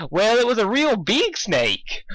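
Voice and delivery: high, slow sheepish cow; embarrassed Matilda. Acted. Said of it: "fidgeted a little embarrassed".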